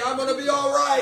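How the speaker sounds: high, loud proclamation of faith; shouted declaration. Shown as a demonstration. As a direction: shout it so the whole church joins in. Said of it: "Say it with me".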